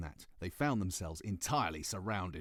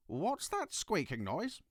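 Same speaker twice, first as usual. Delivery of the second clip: put-on curious little girl; 11 semitones higher, slower than usual. Ketchup Face voiced in story.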